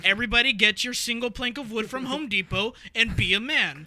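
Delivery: loud hammed-up rallying cry; mock rally shout.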